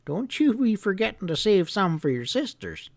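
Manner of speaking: put-on an old storekeeper giving friendly orders; old grandpa voice. Grandpa telling the boy to bring the hounds early, folksy.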